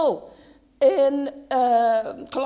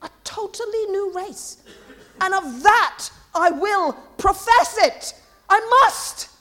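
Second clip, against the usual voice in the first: high-pitched, building to loud insistence; vehement; 5 semitones higher, 6 dB louder than usual. Real, not acted. Preacher vowing to profess the new race, vehement. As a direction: build to an emphatic, forceful finish. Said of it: "That was vehement".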